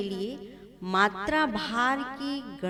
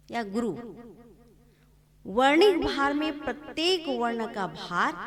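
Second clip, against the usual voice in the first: ordinary talk, nothing marked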